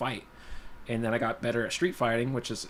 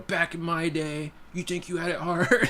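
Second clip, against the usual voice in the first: put-on slow, higher-pitched parody of an old-timer dad; mock dad lecture; 6 semitones higher, slower than usual. Dad telling kid about hard times, mock lecturing. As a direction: drag it out as a joking dad, breaking into laughter. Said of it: "I sound like one of those dads".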